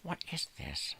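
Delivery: a baffled pet asking; dog's voice. Pretend. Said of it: "He's like".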